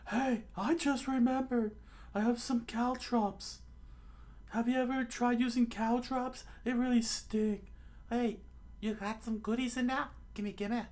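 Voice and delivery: very high falsetto chatter; high put-on dialogue voice; scheming companions. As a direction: squeak it eagerly like a cartoon character.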